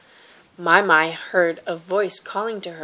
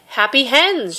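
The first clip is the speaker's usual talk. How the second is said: insistent; 6 semitones higher, 4 dB louder than usual. Acted. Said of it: "she said".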